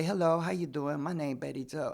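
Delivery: ordinary talk, nothing marked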